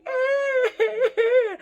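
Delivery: loud, high teary wail; hammed-up joyful crying "Eh"; mock happy sob. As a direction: wail happily like she's overcome. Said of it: "she was like".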